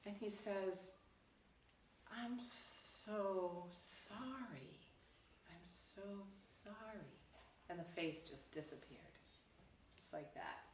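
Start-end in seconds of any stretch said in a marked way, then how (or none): 2.1-7.1 slow, soft, heartfelt regret; tender apology. Acted. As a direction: draw it out softly, full of compassion. Monk acted apologizing to pained face.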